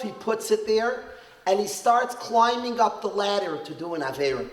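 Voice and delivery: ordinary delivery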